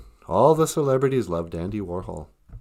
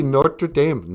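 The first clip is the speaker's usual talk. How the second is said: Minnesota accent; 5 semitones higher than usual. Shown as a demonstration. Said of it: "I totally butchered that with a Mid-, Minnesota accent".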